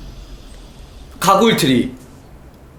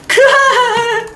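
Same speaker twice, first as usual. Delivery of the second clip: high slow villain cackle; evil cackle; 13 semitones higher, much slower than usual. Pretend. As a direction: cackle like a mad scientist. Villain laugh mid-chase.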